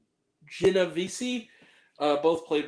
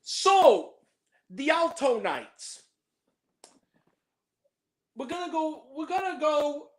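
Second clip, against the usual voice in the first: much higher, slow hammed-up Italian-American; mock mobster accent; 10 semitones higher, slower than usual. Host reviewing a mob film as a wiseguy, mock Italian-American accent. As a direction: play a cartoon wiseguy introducing the movie. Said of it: "sorry for all my Italian viewers".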